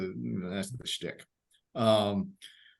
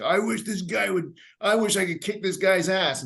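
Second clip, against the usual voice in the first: wishing inmate; 6 semitones higher than usual. Retold or imitated. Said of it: "it's like".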